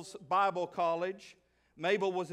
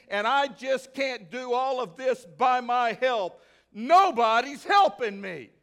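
self-pitying rant; 2 semitones higher, 6 dB louder than usual. Acted. Martha stewing in the kitchen, a building self-pitying rant. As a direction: list the chores, getting more fed up. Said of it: "motivated by this feeling of".

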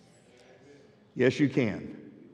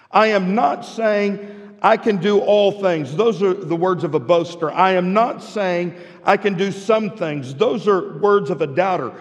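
preaching; 4 semitones higher, 3 dB louder than usual. Acted. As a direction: drive the verse home to the church. Pastor preaching the key verse.

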